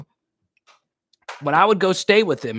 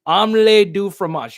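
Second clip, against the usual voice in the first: mock French accent; 5 semitones higher, 6 dB louder than usual. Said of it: "you know this one".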